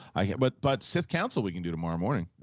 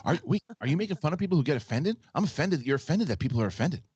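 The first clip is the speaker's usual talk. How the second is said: high, fast, fretful whine of outrage; an overwrought worrier piling on objections; personified anxiety; 5 semitones higher than usual. Pretend. Mocking a cohost for voice impressions, as anxious whiner.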